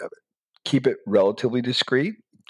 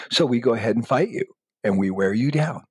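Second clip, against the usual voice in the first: normal talking voice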